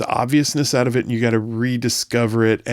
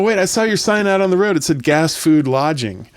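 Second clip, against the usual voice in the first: lightly voiced, high and a bit louder; burrito voice; 6 semitones higher, 4 dB louder than usual. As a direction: play the indignant burrito, lightly.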